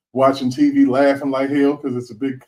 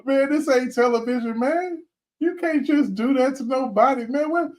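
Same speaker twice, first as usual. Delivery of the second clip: exasperated; 8 semitones higher than usual. Real, not acted. Exasperated rant at judge.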